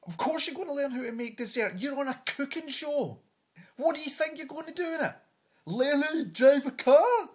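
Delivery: high, incredulous rant building to a shout; exasperated retort. Acted. Comic answering a cooking contestant, exasperated and incredulous. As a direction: fire back in scornful disbelief, rising to loud sarcasm. Mocking reply to gushing contestant.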